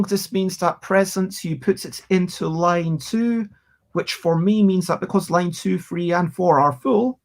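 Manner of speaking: ordinary talk, nothing marked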